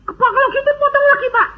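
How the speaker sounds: high, rushed imitation of an eager seller; eager offer. Car seller offering the preacher another discount, eager. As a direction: rush the offer out at high pitch.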